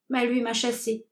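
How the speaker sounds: usual speaking voice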